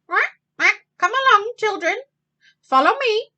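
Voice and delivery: high, loud mother duck call; Mrs. Mallard calling. Acted. Mrs. Mallard calls her ducklings to follow, high and loud. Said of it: "she said one morning".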